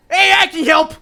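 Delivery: very high, loud cartoon helper; eager character's shout. Game master voicing a character rushing in to help, high shout. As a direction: pipe up loudly in a high voice.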